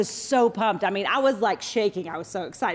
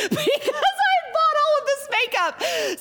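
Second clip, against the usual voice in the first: laughing through the words, pitch jumping up; slower than usual. Real, not acted. Wife laughing as she tells the congregation about the makeup. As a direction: giggle it out at the memory. Laughing at her own story.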